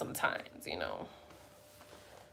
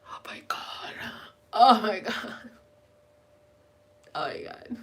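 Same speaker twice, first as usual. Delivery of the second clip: whisper; 3 dB quieter than usual. Real, not acted. Whispered craving over pizza.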